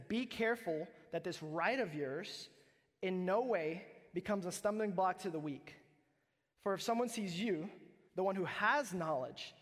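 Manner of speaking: measured, emphatic reading of scripture. Acted. Pastor reading Corinthians to the congregation, emphatic. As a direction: read the verse to the church with weight.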